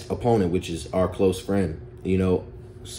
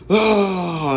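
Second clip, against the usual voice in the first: short raised yell of fury; mock rage cry; 8 semitones higher than usual. Boy raging at kids who hurt his cousin, mock rage cry. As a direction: let out a quick angry ah. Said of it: "I just rage out".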